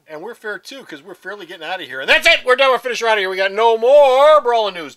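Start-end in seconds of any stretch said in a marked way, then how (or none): 2.0-5.0 raised, loud, building declaration; emphatic wrap-up. Acted. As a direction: declare it over, stretching the final phrase. Abrupt mock final sign-off.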